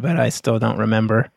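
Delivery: usual speaking voice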